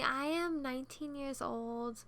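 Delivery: normal talking voice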